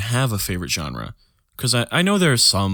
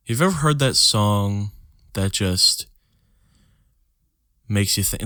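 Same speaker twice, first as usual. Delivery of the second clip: usual speaking voice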